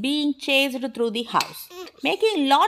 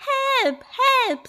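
high-pitched cry for help; storybook mum squealing for help; mock scream; 13 semitones higher, 3 dB louder than usual. A narrator's reading. Mum cries out at the possum, mock scream. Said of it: "screamed Mom".

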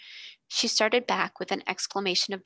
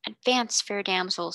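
usual speaking voice

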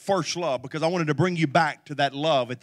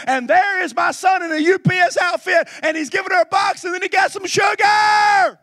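shouted climax; 9 dB louder than usual. Acted.

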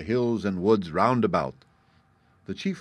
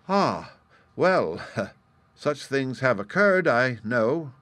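slow, measured reply, somewhat raised; grave; 4 semitones higher, 5 dB louder, slower than usual. A narrator's reading. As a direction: concede it with a touch of gravity. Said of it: "replied the fox gravely".

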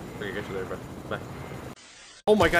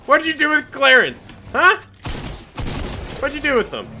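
mock outrage; 9 semitones higher, 4 dB louder than usual. Real, not acted. Outraged at friend Clarence.

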